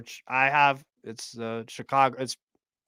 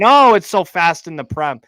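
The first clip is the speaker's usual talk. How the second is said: louder, mocking pundit hype; mocking the tagline; 3 semitones higher, 9 dB louder than usual. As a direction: parrot the hype with a sneer. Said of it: "that's what the whole tagline".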